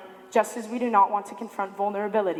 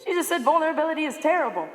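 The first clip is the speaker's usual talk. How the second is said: much higher, fast, mock incredulous voice; imagined skeptical audience; 6 semitones higher than usual. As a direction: voice the doubting crowd, half teasing. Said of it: "many of you are probably thinking".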